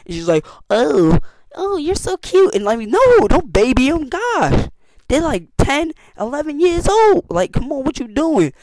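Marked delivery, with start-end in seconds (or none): 0.7-2.5 high, cooing mock of the girl; hammed-up cutesy coo; mocking babying voice. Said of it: "she just puts pity on everybody". 2.5-8.5 exasperated. Real, not acted. Annoyed at girl babying kids.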